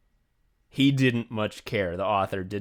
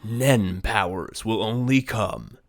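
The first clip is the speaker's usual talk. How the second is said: slow, dramatic trailer announcer; movie trailer voice; slower than usual. Acted. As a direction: narrate it like a film trailer. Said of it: "I wrote a tagline like, like the trailer, movie trailer voice guy would say".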